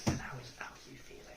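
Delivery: soft unvoiced whisper. Real, not acted. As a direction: whisper the question gently. Interviewer whispering to eaters.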